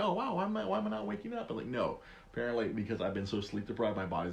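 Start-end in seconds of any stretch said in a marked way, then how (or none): 0.0-1.5 high, surprised self-question. Retold or imitated. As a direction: ask yourself in high surprise. Retold own surprise.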